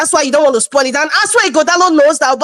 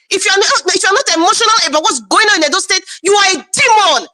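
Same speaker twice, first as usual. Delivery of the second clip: emotional shout; 4 semitones higher than usual. Real, not acted. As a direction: shout it at the critics. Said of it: "I must be emotional".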